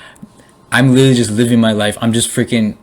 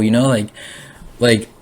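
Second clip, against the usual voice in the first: ordinary talk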